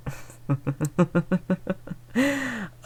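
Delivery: high, airy amused chuckle; amused laugh. Acted. Vampire laughs as the hunter reacts to her glass.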